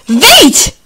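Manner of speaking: sharp high shout, one word. A narrator's reading. Upset girl ordering the woman out, shouted. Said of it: "Krzyknęła".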